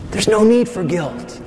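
high, put-on soothing voice; falsely reassure, in a mocking voice; coaxing culture-of-death voice. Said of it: "culture of death today would tell you".

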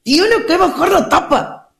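high, drawn-out nagging; hammed-up stubborn complaint; mock whining insistence. Acted client insisting on the lid.

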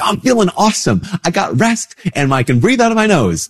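high, bright-eyed enthusiastic reply; mock cheerful. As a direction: gush like you feel amazing. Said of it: "I'm like".

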